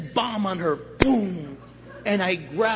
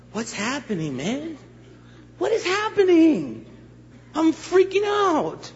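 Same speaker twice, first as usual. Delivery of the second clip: high, drawn-out mock freak-out; hammed-up bewildered panic; acted panic; 9 semitones higher, slower than usual. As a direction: wail it slowly in rising panic. Acting out his panicked freak-out.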